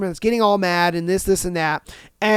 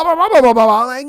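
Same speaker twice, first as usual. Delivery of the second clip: ordinary talk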